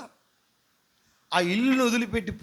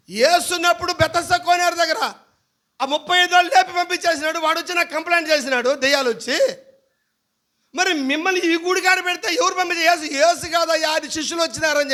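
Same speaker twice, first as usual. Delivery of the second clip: raised, loud preaching; full-force preaching; 7 semitones higher, 4 dB louder than usual. Acted. Pastor preaches the apostles' miracle, loud and raised. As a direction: proclaim it to the whole hall.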